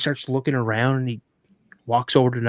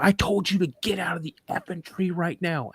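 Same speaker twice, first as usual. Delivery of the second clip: imitating dad's higher-pitched, insistent command; retold angry order; 5 semitones higher than usual. Dad back at the tree stand with frozen son, angry insistence. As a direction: repeat the order, pitched up and exasperated.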